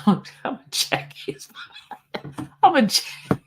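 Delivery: breaking up with real laughter mid-threat; can't get the words out for laughing. Real, not acted. Cracking up over threat to jack him.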